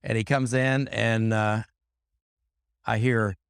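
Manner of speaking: usual speaking voice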